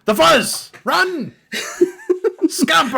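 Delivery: high, loud alarmed cries; hammed-up panicked pirate shout; mock panic shout. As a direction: yell the alarm and flee.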